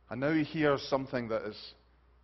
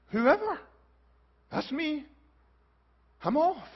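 high, eager exclamations; excited eagerness; 11 semitones higher, 5 dB louder than usual. Acted. Passer-by running to claim a million dollars, excited eagerness. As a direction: jump at it, pitched right up.

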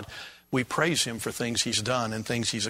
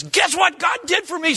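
high, loud, excited brag; excited boast; 4 dB louder than usual. Pretend. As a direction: blurt it out, thrilled. Excited person quoted.

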